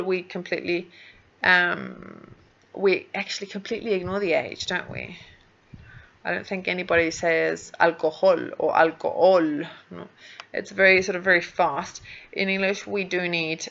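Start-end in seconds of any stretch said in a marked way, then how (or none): none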